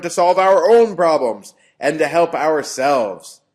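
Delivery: a lecturer half-shouting a dictator's speech; imitated Hitler yell. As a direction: thunder it at the crowd. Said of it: "I'm trying to kind of yell because that's the way he talked".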